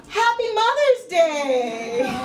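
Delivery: high, drawn-out cheer; exuberant greeting. Acted. Comedian reading out her greeting card, exuberant. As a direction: call it out slow and bright, high-pitched.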